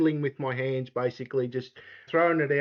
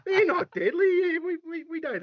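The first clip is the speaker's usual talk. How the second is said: much higher, hammed-up protest; mock indignant; 13 semitones higher than usual. Denying deadly snakes to the host in mock high-pitched outrage. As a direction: pretend to be offended, squeaking.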